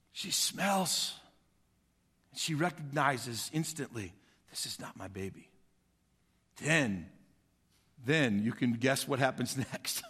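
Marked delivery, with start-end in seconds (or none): 4.5-5.5 low, hushed, stunned; hushed realization. Retold or imitated. Preacher voicing mother's stunned discovery, hushed. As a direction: let the horror sink in quietly.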